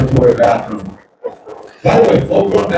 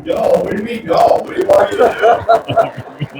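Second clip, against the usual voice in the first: mock indignant protest; 7 semitones higher, much faster than usual. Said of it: "his own retort".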